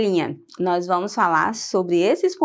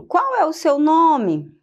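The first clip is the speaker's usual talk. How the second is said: "Qual é o seu nome?" asked slowly, pitched higher, with a clear questioning tune; question intonation; 6 semitones higher, slower than usual. Shown as a demonstration. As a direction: read the example as a real, slow, raised question.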